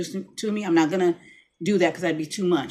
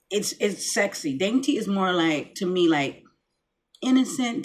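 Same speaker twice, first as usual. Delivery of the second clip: usual speaking voice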